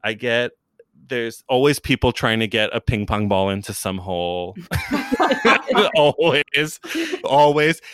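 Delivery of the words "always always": laughing emphatic repeat, louder; giggling insistence. Real, not acted. Joking to the host about endless ping pong videos, laughing.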